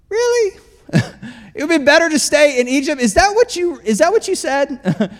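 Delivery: high, incredulous mock question; mock disbelief. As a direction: act amazed they would say that. Mocking the Israelites' complaint.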